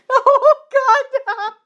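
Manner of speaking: high laughing cry. Real, not acted. Reader in hysterics at the fanfic, high laughing cry. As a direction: burst out laughing as you cry out. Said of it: "laughing at the fic".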